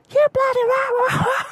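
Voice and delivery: shrill high-pitched mock shout, slow; cussing grandma. Grandma cussing at her husband, high mock shout. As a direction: screech it like a furious old granny. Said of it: "swearing and cussing at the husband".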